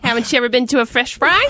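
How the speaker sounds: high-pitched, hammed-up Midwesterner; Midwestern accent. Pundit teasing the host about local customs, Midwestern accent. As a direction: ask it in a put-on Midwestern accent. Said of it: "This is a Midwestern accent".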